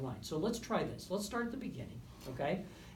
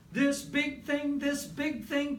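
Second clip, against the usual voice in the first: loud, higher, big and broad; big forte; 12 semitones higher, 7 dB louder, slower than usual. Shown as a demonstration. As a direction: sing out the big forte phrase. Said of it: "to this really exciting thing".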